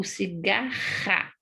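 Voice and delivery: "cigarra" slowed with a long rasped RR; guttural R in "cigarra"; strong double R. Shown as a demonstration. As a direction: stretch out the throaty RR in "cigarra". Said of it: "os dois R's".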